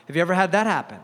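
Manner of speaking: usual speaking voice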